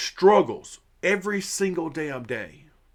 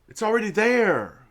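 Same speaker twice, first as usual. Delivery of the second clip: higher and louder, hammering "there" with a falling end; exasperated outburst; 8 semitones higher, 4 dB louder than usual. Real, not acted. To viewers about the transformative goal, an exasperated loud repeat. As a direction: blurt it out, fed up. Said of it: "keeps bringing up the transformative goal".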